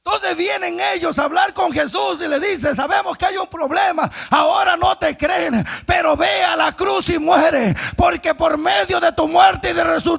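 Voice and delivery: loud, high preaching at full force; fiery preaching. Acted. Preacher shouting the story of the cross to the church. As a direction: shout it to the congregation.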